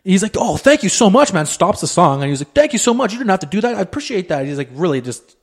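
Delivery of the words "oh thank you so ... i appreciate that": higher, heartfelt thanks; grateful busker. Retold or imitated. Busker thanking the tipper, higher heartfelt gratitude. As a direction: thank him like he made your night.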